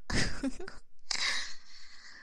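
breathy high giggle; embarrassed whispery giggle. Real, not acted. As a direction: giggle shyly into the mic. Giggle before the performance.

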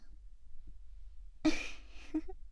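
breathy high giggle; teasing giggle. Acted. Teasing roleplay laugh. (轻笑)

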